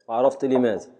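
ordinary talk, nothing marked

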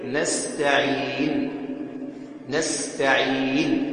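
slow نستعين held long, closed on a vowelless n; stop with sukun. Shown as a demonstration. Teacher shows ishmam after silencing, slow drawn-out recitation. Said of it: "تسكن".

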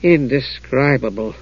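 slow, grim verdict. A narrator's reading. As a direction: draw the word out grimly. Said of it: "Palin said grimly".

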